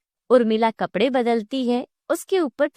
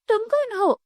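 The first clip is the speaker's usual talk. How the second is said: higher-pitched startled question; mildly alarmed; 11 semitones higher than usual. Acted. Savita faces talking carrots in her dream, mildly alarmed. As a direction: ask with a touch of fright. Frightened woman in nightmare.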